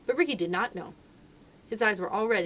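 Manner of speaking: usual speaking voice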